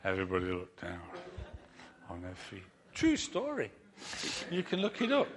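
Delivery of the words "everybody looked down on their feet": dramatic hush as the crowd goes silent. A narrator's reading. Dramatic hush after punchline.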